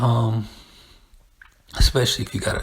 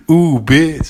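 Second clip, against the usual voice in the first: higher, louder sassy greeting; sassy homegirl; 6 semitones higher, 7 dB louder than usual. Retold or imitated. Mocking girls greeting their homegirls, sassy. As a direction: draw out the ooh with attitude.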